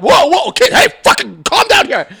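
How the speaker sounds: high, loud calling out; mock alarmed shouting. Adult to kid in bouncy castle, mock shouting. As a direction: yell at a bouncing kid to settle.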